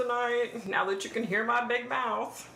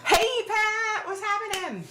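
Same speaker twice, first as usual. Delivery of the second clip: excited greeting; 13 semitones higher, 4 dB louder than usual. Real, not acted. Calling out greeting.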